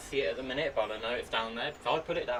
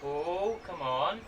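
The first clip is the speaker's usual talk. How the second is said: normal talking voice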